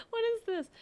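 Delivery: high, loud disbelief; mock shock. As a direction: squeal it in disbelief.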